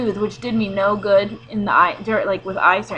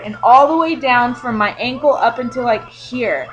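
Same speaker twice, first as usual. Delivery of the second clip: usual speaking voice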